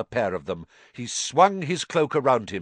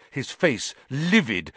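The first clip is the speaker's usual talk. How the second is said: usual speaking voice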